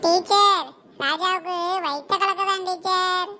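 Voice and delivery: slow, drawn-out classroom call; pupil telling teacher. Acted. Pupil reports Raja's stomach trouble, slow calling-out voice. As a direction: call out to teacher, stretching the words.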